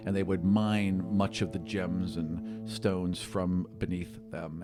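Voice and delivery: usual speaking voice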